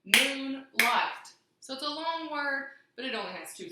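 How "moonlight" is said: Moonlight in two punched beats, loud; clapped syllables. Shown as a demonstration. Teacher clapping out Moonlight's syllables, loud. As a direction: clap out Moonlight, moon then light. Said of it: "Let's clap it out".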